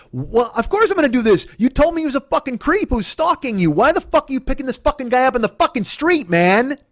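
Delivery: high-pitched, exasperated tirade; outraged rant. Retold or imitated. Boyfriend berating girlfriend for picking him up, outraged. Retold quarrel, boyfriend losing temper.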